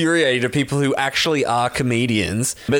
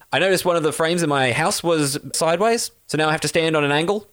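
rapid-fire comic one-liner; fast one-liner delivery. Retold or imitated. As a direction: rush the joke out quickly. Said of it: "he'd talk like that".

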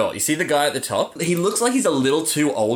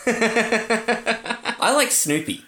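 laughing; 9 semitones higher, much slower than usual. Real, not acted. Joking about Snoopy.

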